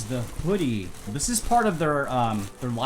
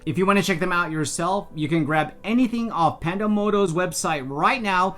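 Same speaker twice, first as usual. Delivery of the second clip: bright, louder ad copy read; sponsor ad read; 4 semitones higher, 5 dB louder than usual. Acted. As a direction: sell it like a scripted spot. Reads sponsor script outro.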